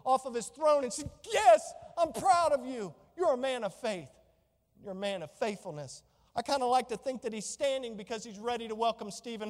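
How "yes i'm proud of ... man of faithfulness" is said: proud Jesus. Pretend. Jesus voiced standing, proud.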